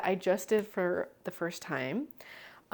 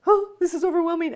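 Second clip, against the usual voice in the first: overwhelmed; 8 semitones higher, 9 dB louder than usual. Retold or imitated. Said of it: "all those emotions too, like, some were like".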